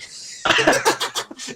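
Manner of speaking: high, loud wheezing cackle; acted laughing fit. Skeleton laughs at his own pun, hysterical. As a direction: crack up at your own joke. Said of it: "keeps laughing at his own jokes".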